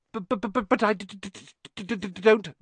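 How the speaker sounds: a timid professor tripping over each word; stuttering Quirrell. Acted. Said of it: "he was stuttering worse than ever".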